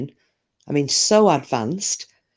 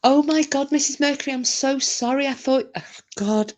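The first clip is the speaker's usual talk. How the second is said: high-pitched, aghast outburst; mortified apology; 10 semitones higher than usual. Real, not acted. Apologising to Missus Mercury for misreading Stoke, mortified. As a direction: realise your blunder and gush out an apology. Realises her mistake, apologises.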